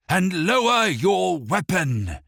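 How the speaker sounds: loud, high shouted order; booming command. A narrator's reading. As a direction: order the guard down. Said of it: "the steward yelled in a booming voice".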